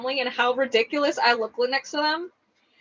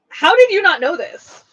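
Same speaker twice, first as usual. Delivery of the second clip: retold incredulous outburst, higher and louder; 6 semitones higher, 8 dB louder than usual. Retelling her reply to clueless mother-in-law, incredulous. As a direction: act out loud disbelief.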